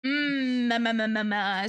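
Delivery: loud chewing mmm; eating sounds. Real, not acted. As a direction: smack and hum while eating. Loud smacking, eating noises.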